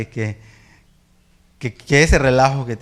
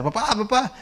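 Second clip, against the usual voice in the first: higher mock panicked calling; 10 semitones higher than usual. Son running back from the store, mock panicked calling. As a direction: yell for dad like a scared kid. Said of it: "empieza a gritar".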